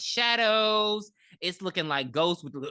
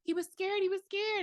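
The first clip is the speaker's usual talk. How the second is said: high mocking voice; 14 semitones higher, 5 dB quieter than usual. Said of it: "and she's like".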